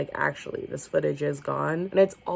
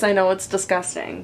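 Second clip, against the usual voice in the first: ordinary talk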